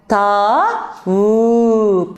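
"tavuk" in long syllables, "taa", then "vuu-k". Shown as a demonstration. Teacher asks if A is there, "tavuk" slowly syllabified. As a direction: stretch both vowels of "tavuk", pausing between syllables. Teacher stretches word again.

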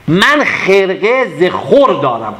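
slow, drawn-out verse line; poem recitation. Acted. Teacher repeating the verse for students, slow and deliberate. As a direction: savour the line as you recite.

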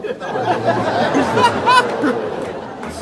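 high and slow, cracking up through the words; laughing disbelief. Real, not acted. Laughing at his own joke.

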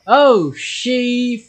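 loud frightened yelling; startled scream. Real, not acted. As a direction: jump scare shriek. Jump scare in horror game.

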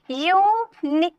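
Uni- slowly in two pieces, yu, ni; syllable by syllable. Shown as a demonstration. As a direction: draw out uni- with a gap, then stop. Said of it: "यू अलग हो गया".

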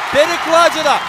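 loud startled call; excited shout. Real, not acted. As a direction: yell it as the puck rings off. Shot hits the crossbar.

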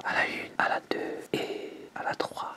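slow, hushed whisper; little voice. Shown as a demonstration. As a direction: count it off in a tiny whisper. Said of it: "on va le faire avec la petite voix".

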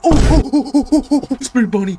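high, loud, stuttering string of ohs; startled panic in the game; panicked cry. Real, not acted. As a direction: yelp in rapid bursts at a sudden sighting. Jump at seeing Spring Bonnie.

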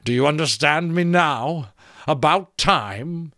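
testy talking skull; impatient skull. A narrator's reading.

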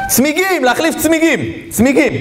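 high-pitched ad slogan parody; mock advert shout. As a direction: call it out high like a silly commercial. Mimicking a tyre advertisement. (חיקוי מכריז פרסומת בקול גבוה)

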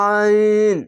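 আইন with a long held vowel and ringing n; chant-like, drawn-out আইন; slightly thick. Shown as a demonstration. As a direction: stretch আইন level, from the throat. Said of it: "একটু মোটা করে পড়বেন".

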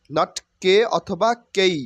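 usual speaking voice

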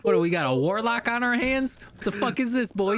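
high-pitched outraged tirade; indignant officer's dressing-down; angry scolding. Pretend. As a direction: chew him out, pitch jumping up. Officer berates a suspect.

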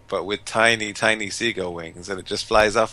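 normal talking voice

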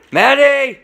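calling out to someone upstairs; yelling across the room. Real, not acted. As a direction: call her name across the house.

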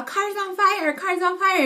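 high-pitched mock alarm, repeated; panicky yelling. Driver shouting at hikers about his burning car, mock panic. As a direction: imitate a frantic man as his engine burns. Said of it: "He's all".

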